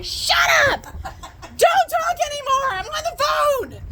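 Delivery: very high, screeching mock shout; hammed-up shriek, drawn out; retold yelled order. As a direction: re-enact yelling frantic orders at an injured parent. Retold yelling at dad during call.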